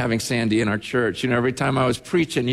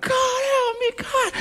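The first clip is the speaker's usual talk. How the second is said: retold shriek, pitched way up; imitated scream; slower than usual. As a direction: imitate a desperate cry of pain to God. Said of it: "she's just screaming out".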